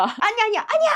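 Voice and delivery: high-pitched urgent no; imitated flustered protest; acted urgent objection. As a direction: cut in with a high, hurried correction. Woman rushing over to correct driver.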